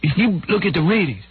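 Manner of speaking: Soupy explaining himself. Retold or imitated. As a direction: plead your case in a hammed-up impression. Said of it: "He would go".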